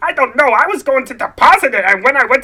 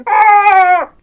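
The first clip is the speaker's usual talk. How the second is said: loud furious cry; angry roar; 6 semitones higher, 8 dB louder than usual. Acted. George ending his rant at his uncle, angry roar. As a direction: let out a raging yell.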